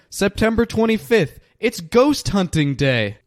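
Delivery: excited. Real, not acted. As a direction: burst out with the date, thrilled. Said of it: "how excited I was about today".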